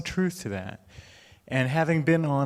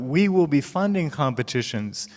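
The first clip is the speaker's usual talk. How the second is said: usual speaking voice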